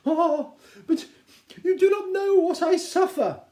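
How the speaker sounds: milk her suffering; self-pitying Mrs Bennet. Acted. Wife moaning to husband, high and self-pitying.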